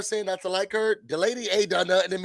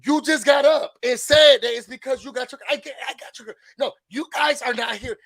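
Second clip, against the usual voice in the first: louder retold yell at the lady; mock shouting; 6 semitones higher, 5 dB louder than usual. Ladies shouting at Ramona for making it about herself. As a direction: call her out loudly. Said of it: "And it's like".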